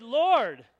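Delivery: loud, high pledge to the Lord; fervent loud vow. Acted. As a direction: declare it with full conviction. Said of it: "he said".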